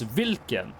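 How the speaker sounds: hvilken said the Norwegian way, vil-ken. Shown as a demonstration. Teaching chat the Norwegian word for which. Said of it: "which is".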